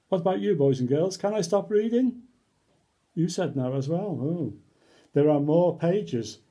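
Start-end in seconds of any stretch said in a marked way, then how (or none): none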